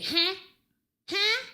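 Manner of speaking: high mock huh; mocking boyfriend's huh; mimicking his huh. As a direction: imitate a clueless repeated huh. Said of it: "no, you say".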